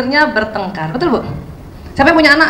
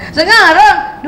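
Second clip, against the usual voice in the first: put-on whining child; 4 semitones higher, much slower than usual. Shown as a demonstration. Child insisting.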